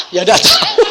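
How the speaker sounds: high, loud, laughing shrug of an answer; laughing girl's answer. Retold or imitated. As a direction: voice the daughter giggling she doesn't know. Daughter's answer voiced, laughing.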